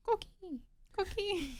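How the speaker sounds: high, soft chirping coqui; coqui frog call. Retold or imitated. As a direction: imitate the little frog's chirp. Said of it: "What is the sound the Puerto Rican frog makes?".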